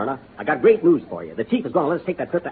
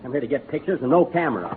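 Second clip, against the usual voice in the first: ordinary talk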